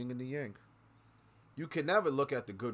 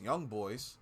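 usual speaking voice

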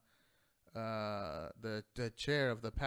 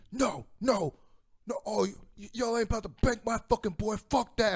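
much higher and louder, a fired-up, protective yell; imitating a friend's shout; 9 semitones higher, 8 dB louder than usual. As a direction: burst in like the cavalry defending your boy. Friend charges in defending him.

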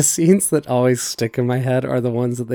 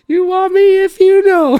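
very high, loud put-on meme voice; 7 dB louder than usual. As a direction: squeal it like a silly meme. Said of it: "and it's like".

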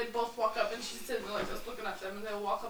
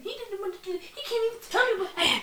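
higher, hammed-up confrontation; mock accusation; 7 semitones higher than usual. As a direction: accuse him, climbing higher as you go. Retold accusation in a quarrel.

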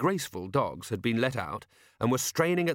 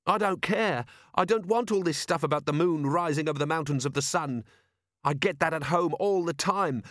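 put-on high, heated teenage rant; angry boy voice; 6 semitones higher than usual. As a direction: vent like a fed-up teenager. Boy's angry outburst.

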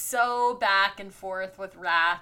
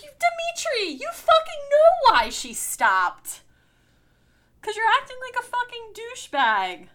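high, loud outraged telling-off; angry scolding; 7 dB louder than usual. Acted. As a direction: chew him out, indignant.

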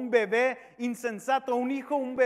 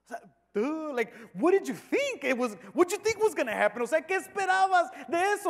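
high-pitched incredulous scoffing; mocking crowd; 7 semitones higher than usual. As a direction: act out a scoffer's exasperated disbelief. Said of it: "toda la gente riéndose de él". (burla incrédula, con voz aguda)

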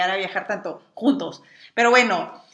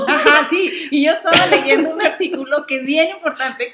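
riendo al hablar; 4 semitones higher, 8 dB louder than usual. Real, not acted. Cuenta riendo sobre garnachas.

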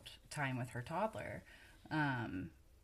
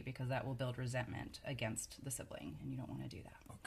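hushed aside; whispering; 5 dB quieter than usual. Real, not acted. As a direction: drop to a whisper. Warning aside, dropped voice.

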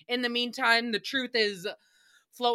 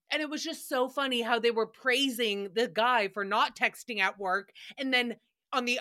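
ordinary talk, nothing marked

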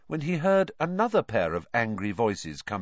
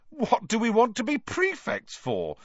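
disgusted at the very idea; revolted. A narrator's reading. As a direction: scoff at the thought. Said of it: "looking revolted at the very idea".

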